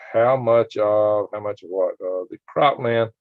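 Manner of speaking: normal talking voice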